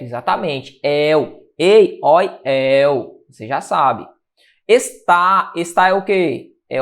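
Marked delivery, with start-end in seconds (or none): 0.8-3.2 "El, ei, oi, el" each said separately with an open vowel; open diphthongs. Shown as a demonstration. Teacher reciting the open diphthongs, "El, ei, oi, el" with open vowels. 4.7-5.5 "Está" broken in two, landing firmly on "tá"; stress on last syllable. Shown as a demonstration. Teacher showing where the word's stress falls, "Está" stressed on "tá". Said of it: "a sílaba tônica é a última".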